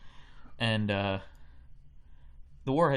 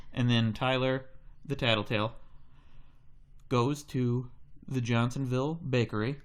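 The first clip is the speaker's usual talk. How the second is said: ordinary talk, nothing marked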